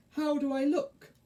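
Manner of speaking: high-pitched fairy voice; Mavis. A narrator's reading. Fairy shows herself off to caterpillar, high fairy voice. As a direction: ask it high, fishing for a compliment.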